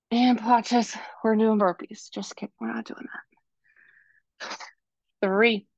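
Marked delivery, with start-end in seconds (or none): 2.1-4.6 playful whispered aside. Real, not acted. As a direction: whisper the joke like a secret. Joking aside to the class.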